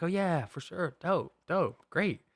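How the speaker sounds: a mock-bright yes while feeling left out; fake enthusiasm. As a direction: pretend you're totally fine with it. Fakes enthusiasm at groomsmen's plans.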